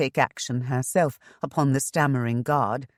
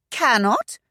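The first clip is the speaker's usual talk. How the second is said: high rising disbelieving echo; incredulous; 10 semitones higher, 5 dB louder than usual. A narrator's reading. Shanna echoing the agent's refusal, high and incredulous. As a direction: throw his word back in disbelief. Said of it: "Shanna repeated incredulously".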